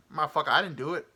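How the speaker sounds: mock indignant man denying it; punchy imitation of an accused passenger; impression of male passenger. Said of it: "He was just like".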